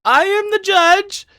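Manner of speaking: very high, loud judge's outburst; indignant shriek. Acted. Judge told to sit down, shrieking back indignantly. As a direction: assert authority at the top of the voice. Judge overrules, insisting brother dead.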